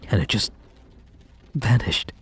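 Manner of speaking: breathy whisper of loss. Acted. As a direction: let the word fade to a whisper. Grieving lost relationship.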